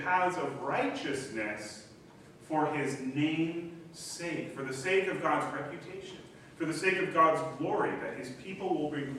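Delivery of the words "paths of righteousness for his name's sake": slow emphasis. Shown as a demonstration. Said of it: "psalm line quoted slowly".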